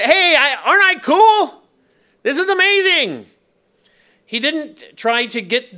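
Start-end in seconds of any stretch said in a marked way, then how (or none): 0.0-3.3 high, loud, hammed-up bragging; mock show-off. Preacher mimicking what Jesus didn't say to crowds, mock showing off. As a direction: crow like a cartoon attention-seeker.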